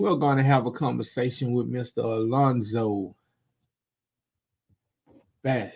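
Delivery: usual speaking voice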